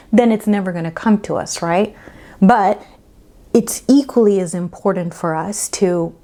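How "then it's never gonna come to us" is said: ordinary delivery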